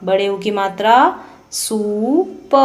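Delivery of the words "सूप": सूप said very slowly; slow spelling-out. Shown as a demonstration. Teacher slowly sounding out सूप. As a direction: draw सूप out slowly first. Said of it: "लंबे समय तक और ऊंचे स्वर में पढ़ा".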